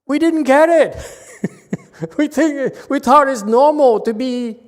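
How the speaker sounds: high, loud voice full of real laughter; cracking up mid-sentence; laughing while talking. Real, not acted. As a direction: push the words out through laughter. Cracking up at his own impression.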